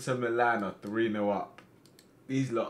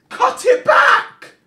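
high, loud, slow yell; urgent shout; 17 dB louder, much slower than usual. Real, not acted. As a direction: scream the instruction at the screen. Urging player on screen.

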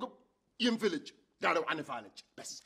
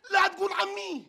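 shout; 8 semitones higher, 5 dB louder, slower than usual. Acted. Heated comic insult exchange.

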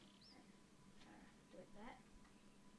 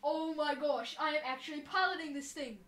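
loud, thrilled exclamation; excited amazement; 3 semitones higher, 8 dB louder than usual. Real, not acted. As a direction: burst out in disbelief. Amazed at piloting the mech.

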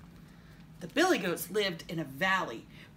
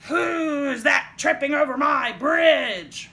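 slow, loud, raised troll roar; 7 semitones higher, 11 dB louder, slower than usual. A narrator's reading. Troll challenging the youngest goat on his bridge, roaring. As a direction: bellow it, stretching the words out. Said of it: "roared the troll".

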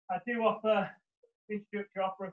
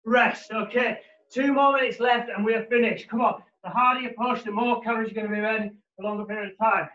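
gym bro; 5 semitones higher, 9 dB louder, slower than usual. Acted. Fitness coach calling workout cues.

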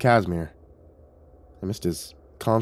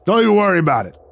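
higher, louder call over the shoulder; 8 semitones higher, 5 dB louder than usual. A narrator's reading. Vic to Billy while walking away, calling out. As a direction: toss it back loudly as you leave. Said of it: "He called over his shoulder".

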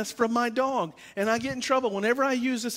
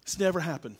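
normal talking voice

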